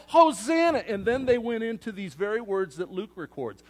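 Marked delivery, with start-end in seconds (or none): 0.1-0.8 "Hosanna!" called out high and loud; cried out hosanna. Retold or imitated. Preacher voicing the crowd, calling out loud and high. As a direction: cry it out to the king arriving. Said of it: "They cried out".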